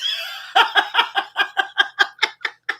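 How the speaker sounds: high helpless cackle; delighted rolling laugh; cracking up. Real, not acted. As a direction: laugh long and breathlessly. Cracking up at comment.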